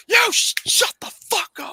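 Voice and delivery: high, furious outburst; angry yell. Retold or imitated. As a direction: snap at them angrily. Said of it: "He's like".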